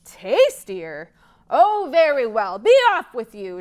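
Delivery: loud greedy troll; troll tempted by a bigger meal; shouting troll. Acted. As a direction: shout him away. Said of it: "shouted the troll".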